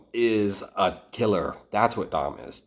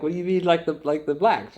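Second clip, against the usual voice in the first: higher, disbelieving repeat of like the blacks; incredulous echo; 7 semitones higher, faster than usual. Retold or imitated. Asking Dom what he means, incredulous. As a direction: throw the phrase back in disbelief. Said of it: "I was like".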